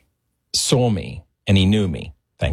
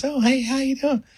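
Michael's casual greeting. Retold or imitated. Michael greeting a stunned fan, high and breezy. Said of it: "He goes".

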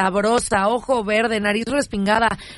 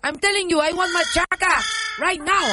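demanding wife; 6 semitones higher than usual. Acted. Wife demands it from her husband, high and insistent. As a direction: push hard, you want it now.

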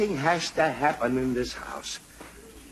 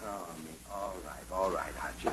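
low, soft sigh of surrender; weary resignation; 11 semitones lower, 12 dB quieter than usual. Acted. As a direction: give in with a tired groan. Father gives in to son.

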